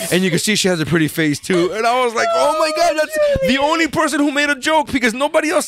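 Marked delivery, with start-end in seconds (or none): 2.3-5.7 high-pitched mock disbelief; retold indignant outburst; exasperated protest. Retold protest at being blamed.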